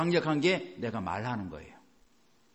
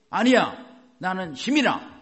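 high, louder burst of resolve; defiant pep; 4 semitones higher, 6 dB louder than usual. Acted. Preacher countering tired self-talk, forceful and upbeat.

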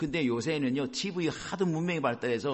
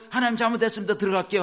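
high, loud imitation of pleading; mock apology; 6 semitones higher, 5 dB louder, faster than usual. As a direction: blurt a hammed-up apology on a raised pitch. People's hasty apology to God, acted. (높고 크게 잘못을 비는 흉내)

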